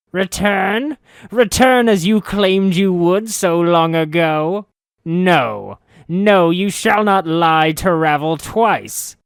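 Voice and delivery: high, very loud furious shriek; the witch screams her betrayal, slowing on you shall not lie. Acted. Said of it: "as she shrieked".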